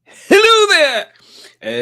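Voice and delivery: very high, loud, long-drawn "hello there"; catchphrase call. Acted. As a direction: belt out the greeting, stretched right out. Mimics the catchphrase after the clip.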